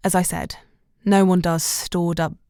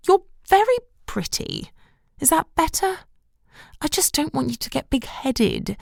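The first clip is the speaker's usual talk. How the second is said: a touch higher, grudging mother; grudging compliment from a cautious mum; mother's grudging compliment, lightly voiced; 10 semitones higher than usual. A narrator's reading.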